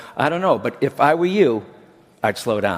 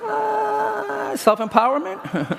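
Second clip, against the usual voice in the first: high, embarrassed, laughing reply, drawn out slowly; sheepish laughing answer; much slower than usual. Acted. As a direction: squirm out the answer through a laugh. Sheepish answer in mock dialogue.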